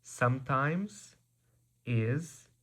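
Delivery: usual speaking voice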